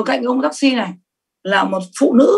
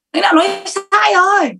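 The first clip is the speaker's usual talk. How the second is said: mock contradicting the leader; 7 semitones higher than usual. Acting out her past self contradicting the leader.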